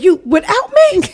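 high laughing disbelief; You, without me? shrieked; shocked. Retold or imitated. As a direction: squeal it in mock outrage. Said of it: "I said".

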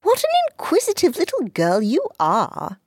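put-on high, preachy boy's voice; sententious schoolboy. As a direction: lecture her like a prig. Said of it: "said Paul sententiously".

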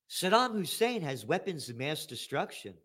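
soft, sarcastic official tone; mock proper statement. Host mimics officials saying a war lie softly. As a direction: deliver the lie in a polite hush. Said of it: "How about this for a cuss word".

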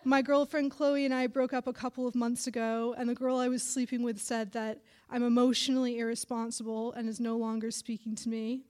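ordinary delivery, nothing marked

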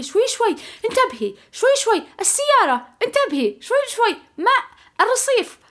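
very high, frantic passenger warnings; imitated panicky sister. Pregnant sister warns the driver of water and curb, imitated panic. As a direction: mimic a hysterical backseat driver, pitch shooting up. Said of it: "وهي منهارة تقول لي".

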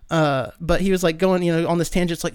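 ordinary delivery

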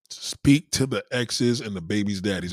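ordinary talk

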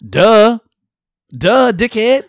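mocking a clueless customer; mocking duh. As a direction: sneer it at the dummy.

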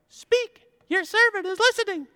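put-on very high squeaky child's voice; helium voice. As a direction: answer like a tiny boy on helium. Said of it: "should have had helium".

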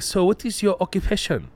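put-on officer with Japanese accent; clipped Japanese accented question. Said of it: "Your Japanese accent is fucking spot on".

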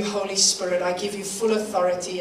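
normal talking voice

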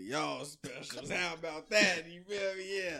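loud, high hype-man shout. Real, not acted. Hyping up his specials.

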